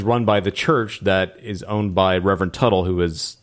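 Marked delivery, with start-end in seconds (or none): none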